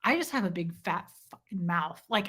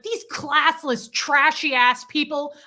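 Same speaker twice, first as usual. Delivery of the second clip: slow, loud, higher scornful rant; loud insult; 5 semitones higher, 7 dB louder, slower than usual. Retold or imitated. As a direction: sneer it out loud. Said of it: "And I'm yelling".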